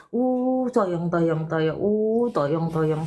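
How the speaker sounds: cooing comfort. Real, not acted. Host consoles a crying caller, cooing comfort. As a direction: coo soothingly, stretching each "uh".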